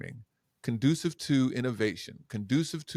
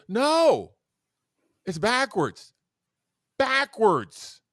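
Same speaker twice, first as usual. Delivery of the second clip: higher and louder, insisting; emphatic no; 9 semitones higher, 6 dB louder, much slower than usual. Real, not acted. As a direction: hit backwards hard. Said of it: "stressed correction".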